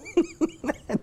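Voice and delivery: high incredulous laugh that breaks out; burst of laughter. Real, not acted. Host laughing at a minister's husband's tweet, incredulous laugh.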